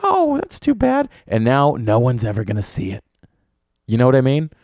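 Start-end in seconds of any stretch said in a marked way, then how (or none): 0.0-1.1 put-on high, slow falsetto kid, let down; disappointed kid. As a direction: sigh it out in a small child's voice. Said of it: "some kid walked in".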